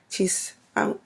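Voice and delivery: normal talking voice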